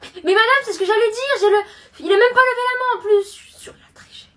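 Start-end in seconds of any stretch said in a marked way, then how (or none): 3.2-4.3 unvoiced whisper aside. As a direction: hiss it to the side. Muttered accusation aside.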